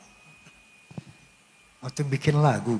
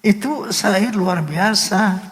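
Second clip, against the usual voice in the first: high, slow, emotional wonder; overwhelmed praise; 7 semitones higher, slower than usual. Real, not acted. Singer moved by the song he just sang, overwhelmed praise. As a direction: draw the praise out slowly in a raised voice.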